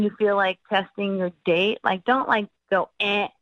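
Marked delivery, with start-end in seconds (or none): none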